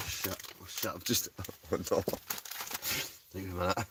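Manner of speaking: breathless whispered swearing; hushed oh-no after dropping; muttered panic. Real, not acted. Drops the mince pies.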